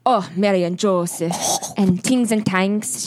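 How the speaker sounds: playful stock-phrase Irish accent; kind-of-Irish accent. Shown as a demonstration. Showing cohost the only Irish she can do, rough Irish accent. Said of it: "I can do kind of Irish".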